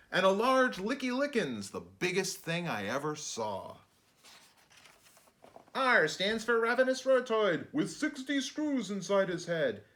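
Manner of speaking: put-on lively sing-song read-aloud for kids; bouncy picture-book narration; rhyming storybook reading. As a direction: read it like a bedtime rhyme to children.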